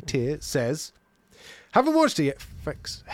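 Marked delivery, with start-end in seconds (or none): 1.7-2.4 high, loud, punchy reading; strong start. Acted. Host reads a listener's comment, coming in strong. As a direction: launch hard into the first words. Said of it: "I started into it so strong".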